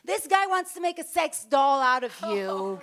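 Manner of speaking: a husband fuming over the deal; imitating her angry husband David. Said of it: "I knew that he was very angry".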